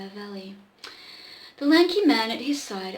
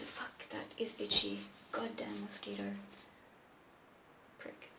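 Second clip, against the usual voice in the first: whispered annoyed aside; 13 semitones lower, 11 dB quieter than usual. Real, not acted. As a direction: whisper it under breath. Aside about a mosquito bite.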